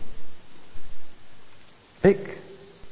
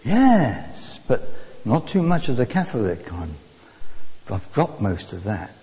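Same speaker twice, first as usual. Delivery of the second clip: imitated Polish woman's accent; reply with a light Eastern European accent; 8 semitones lower, 3 dB quieter than usual. Preacher quotes the Polish passenger on Catholicism, Eastern European accent. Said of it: "Eastern European accent".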